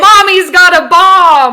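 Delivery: high, full-volume yell; mock child's yell. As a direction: shriek it like a kid telling on mom. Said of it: "At least he didn't yell".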